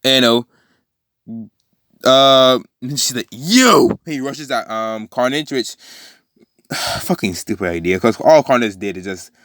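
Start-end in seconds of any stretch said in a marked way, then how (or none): 3.3-4.0 high, drawn-out accusing call; acted-out pitched-up challenge; accusing cry. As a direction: cry it out before charging at an enemy. Character spots enemy and rushes.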